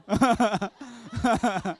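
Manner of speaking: big burst of laughter from the pulpit; loud laugh. Real, not acted. Preacher laughs loud at his own story, a big laugh. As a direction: laugh out loud, high and full.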